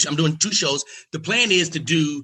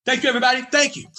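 high mock gracious farewell; 11 semitones higher, much faster than usual.

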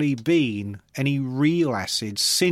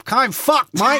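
loud, high Australian "I'm fucked, mate"; Australian accent; 9 semitones higher, 6 dB louder, slower than usual. Pretend. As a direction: shout it like an Aussie bloke. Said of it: "If you say it in an Australian accent".

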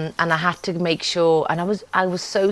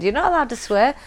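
ordinary talk, nothing marked

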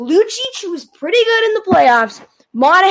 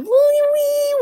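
tiny high wee; 9 semitones higher than usual. Shown as a demonstration. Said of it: "wee wee little second".

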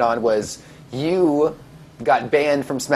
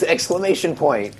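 ordinary delivery

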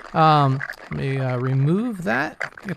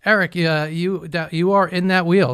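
normal talking voice

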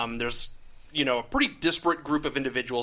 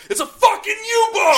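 screamed alarm, much higher and louder; acted shout; 9 dB louder than usual. The other boat's crew yelling at the U-boat, full shout. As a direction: bellow it like a sailor spotting the enemy. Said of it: "other boat's like".

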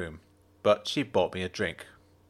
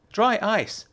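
Jenna's voice; 10 semitones higher, 3 dB louder than usual. A narrator's reading. Jenna's voice in dialogue.

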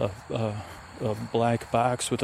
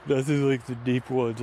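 usual speaking voice

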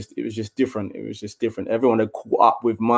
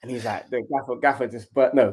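ordinary talk, nothing marked

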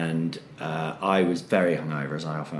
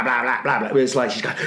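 ordinary delivery

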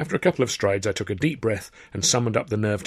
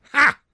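short loud high triumphant laugh; 10 dB louder than usual. A narrator's reading. Tilly crowing at the counter, loud triumphant ha. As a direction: crow with triumph.

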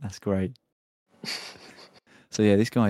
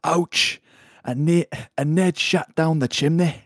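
mock Scottish; 6 semitones higher, 4 dB louder than usual. Reading Scottish man's quote.

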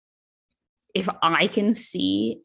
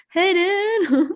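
"Hey, dude" pitched very high and louder; changed pitch; 10 semitones higher, 5 dB louder than usual. Shown as a demonstration. Showing a failed British accent, "Hey, dude" pitched very high. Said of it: "changing the pitch of my voice".

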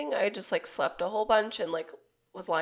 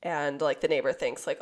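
ordinary delivery

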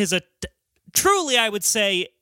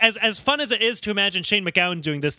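usual speaking voice

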